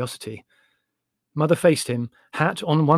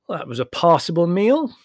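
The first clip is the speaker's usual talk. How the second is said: generously; 6 semitones higher, 7 dB louder than usual. A narrator's reading. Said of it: "he said generously".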